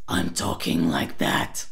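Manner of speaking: low growly rattled take; rattle voice. Shown as a demonstration. Coach shows the transfer exercise, rattle voice. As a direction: switch into a deep raspy rattle. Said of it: "try this transfer exercise in one breath".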